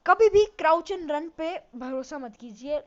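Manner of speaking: loud and slow, every word weighted; emphatic warning. Real, not acted. Gamer warning viewers never to trust crouch and run, slow loud emphasis. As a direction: hammer the warning home slowly.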